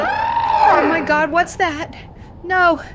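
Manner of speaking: panicked screams as the thing appears; terrified scream. Acted. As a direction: scream in horror at what's coming. Sees the creature, final recording.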